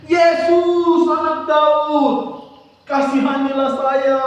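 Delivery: high, drawn-out calling cry; pleading shout from the roadside. A narrator's reading. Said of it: "dia mulai berteriak".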